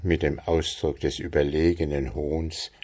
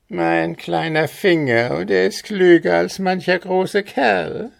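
higher, teasing goblin voice; sly goblin; 12 semitones higher, 3 dB louder than usual. A narrator's reading. Goblin tempts Andres with the way, high and sly. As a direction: dangle the secret slyly.

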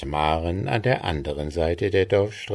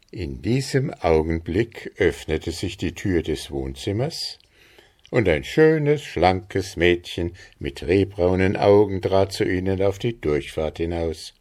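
ordinary delivery

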